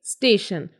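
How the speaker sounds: स्टेशन, sh in the second syllable, falling; correct SH reading. Shown as a demonstration. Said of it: "it's स्टेशन".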